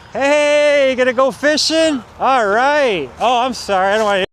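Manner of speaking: loud, high sarcastic taunt; sneering yell across the lot; mocking call-out. Real, not acted. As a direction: shout mockingly at the people watching. Taunting the watchers nearby.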